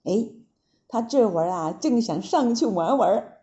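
usual speaking voice

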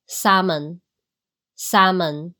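salmon slowly, with the L left silent. Shown as a demonstration. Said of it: "但是L并不用读出来". (慢慢示范salmon，L不发音)

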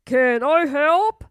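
put-on slow, loud old official; prime minister voice. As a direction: ask like a pompous minister. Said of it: "asked the prime minister".

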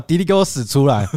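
half-joking furious yell; mock angry shout. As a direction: pretend to roar at the boy. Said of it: "我真的是这个口气哦".

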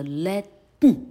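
Latin with a forced nasal burst; exaggerated syllabic n. Shown as a demonstration. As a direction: overdo the n of Latin through the nose. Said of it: "这是夸张念法".

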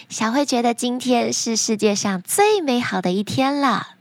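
ordinary delivery, nothing marked

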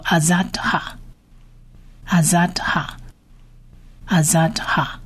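"azadha" in careful syllables, a-zat-kha; Hebrew pronunciation model. Shown as a demonstration. Teacher puts the letters together for learners, careful pronunciation model. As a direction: say "azadha" as a-zat-kha, with long pauses between repeats. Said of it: "所以你的筹算叫做".

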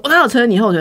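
mock indignant snap; retold angry retort. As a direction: throw his indignant denial back at her. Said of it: "他就想要发脾气啊".

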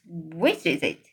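ordinary delivery, nothing marked

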